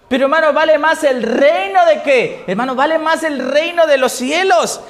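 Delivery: preacher raising the voice over the church; fervent preaching. Acted. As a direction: drive the verse home to the congregation. Preaching at full force.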